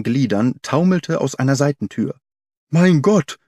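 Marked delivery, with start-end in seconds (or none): 2.7-3.4 loud, high exclamation of alarm; horrified cry. A narrator's reading. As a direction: cry out aghast, pitched up. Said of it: "rief er".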